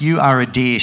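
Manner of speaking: mild storybook shout. A narrator's reading. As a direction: raise the volume to contradict, without a full shout. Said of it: "shouted the deer".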